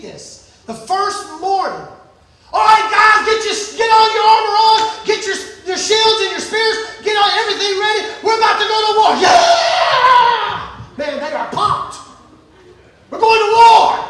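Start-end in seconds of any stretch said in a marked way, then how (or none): 2.5-9.2 loud, higher-pitched soldier rallying the troops; pumped-up rallying. Acted. Acting soldiers pumped for first battle. 9.2-10.8 long, loud high battle yell; war cry. Acted. 13.1-14.0 loud, high battle shout; war cry. Acted. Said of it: "the war cry is on".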